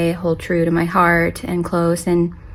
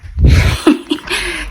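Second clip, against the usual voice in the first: laugh; 11 semitones higher than usual. Real, not acted. Laughs at chaos.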